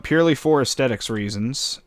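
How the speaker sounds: ordinary delivery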